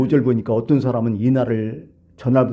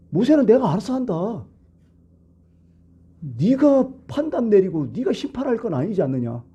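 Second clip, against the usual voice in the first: God's stern rebuke; 7 semitones higher than usual. Acted. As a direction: speak as God defending his servant. God rebukes Miriam and Aaron. (하나님이 미리암과 아론을 꾸짖듯, 높고 느린 엄한 목소리)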